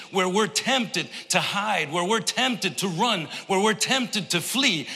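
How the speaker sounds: high, building preacher cadence as Jesus speaks; preaching as Jesus. Acted. Pastor to congregation, voicing Jesus, high preaching cadence.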